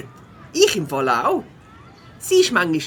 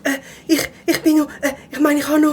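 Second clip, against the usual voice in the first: flustered stammer; 7 semitones higher, 5 dB louder than usual. Acted. Late pupil caught by teacher.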